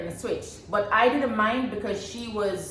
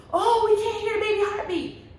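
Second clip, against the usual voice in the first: high, worried nurse voice, lightly voiced; imitating the nurses' worried chatter; nurses' alarm; 12 semitones higher than usual. Mother retells nurses voicing alarm, high and worried.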